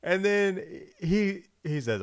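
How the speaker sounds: ordinary talk, nothing marked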